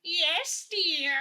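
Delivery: put-on high, sweet old lady. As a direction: play a doting granny. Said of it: "bust out my old lady voice".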